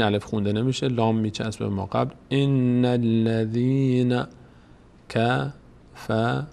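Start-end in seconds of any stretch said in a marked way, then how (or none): 2.3-6.5 slow chanted recitation; Quran recitation. Shown as a demonstration. Teacher reciting verse six, slow chant. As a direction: intone slowly, doubling the ن.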